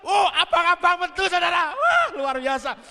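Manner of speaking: loud and high, then slowing in awe; excited shout. Retold or imitated. Pastor reliving joy as red notes come out, shouted. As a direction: burst out at the red notes appearing.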